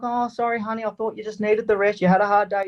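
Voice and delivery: high, sweet, lightly voiced apologetic wife; wife's voice. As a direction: sound like the gentle wife saying sorry. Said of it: "She's like".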